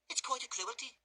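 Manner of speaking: put-on high, coy young woman's voice; a sweet simpering maid; lightly voiced Charlotte. A narrator's reading. Maid coaxing the drunk young man, high and coy. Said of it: "said Charlotte".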